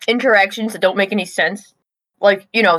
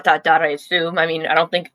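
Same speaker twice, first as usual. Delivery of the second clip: normal talking voice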